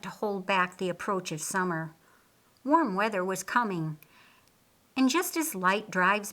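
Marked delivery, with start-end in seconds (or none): none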